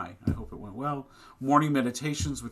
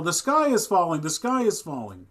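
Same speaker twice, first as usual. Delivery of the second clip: mock yell; 6 semitones higher, 8 dB louder than usual. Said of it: "every day he would yell".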